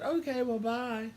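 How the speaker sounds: high, curt brush-off; a snippy high goodbye; dismissive. Retold or imitated. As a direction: cut him off and leave. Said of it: "And I said".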